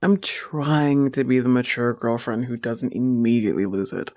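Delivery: usual speaking voice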